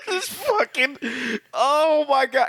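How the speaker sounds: high, laugh-choked words; can barely talk for laughing; real giggles pushing the voice up. Real, not acted. Cracking up at his joke.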